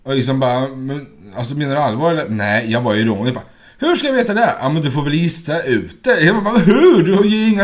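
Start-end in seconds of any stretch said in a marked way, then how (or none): 3.8-7.6 higher, louder, quick "how am I supposed to know"; exasperated retort. Retold or imitated. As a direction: throw up your hands in mock disbelief. Retelling exasperated exchange with friend. (uppgivet, högre och snabbare)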